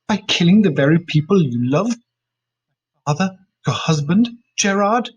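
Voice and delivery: loud pained accusation; angry. A narrator's reading. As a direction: hurl the charge at her. Daughter accuses mother.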